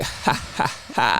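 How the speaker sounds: soft, separated mock ha-has; put-on staccato laugh; imitated forced laugh. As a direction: laugh like a guy playing it cool. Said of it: "starts laughing where he's like".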